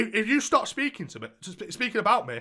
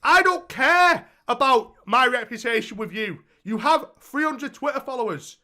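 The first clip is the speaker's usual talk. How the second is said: heated rant; 7 semitones higher, 7 dB louder than usual. Retold or imitated. Ranting back at ex in retelling.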